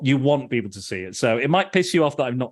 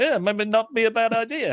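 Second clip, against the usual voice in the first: mock menacing mobster; 11 semitones higher than usual. Retelling Paulie's reply to friend, pitched up. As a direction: tease the idea with a pitched-up voice. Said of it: "And he's like".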